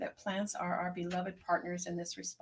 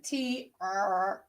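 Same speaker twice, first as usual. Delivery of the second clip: playful growl; 7 dB louder than usual. Retold or imitated. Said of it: "sometimes I'm just like".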